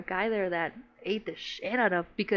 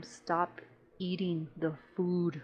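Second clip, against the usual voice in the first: slow, soft mock scolding; hushed retold scolding; 3 semitones lower, 6 dB quieter, much slower than usual. Extra telling off another extra, hushed. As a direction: scold under your breath, drawing it out. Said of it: "the scene was so low".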